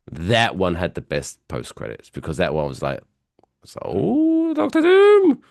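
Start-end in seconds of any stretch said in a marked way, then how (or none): 3.6-5.4 very high rising exclamation, ending loud; mock gasping reveal. Mocking the post-credit scene's surprise, high hammed-up exclamation. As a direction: swoop way up into an exaggerated reveal.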